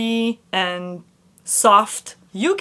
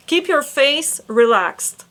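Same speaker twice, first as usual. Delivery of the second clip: usual speaking voice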